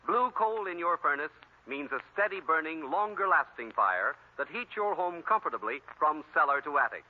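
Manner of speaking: ordinary talk, nothing marked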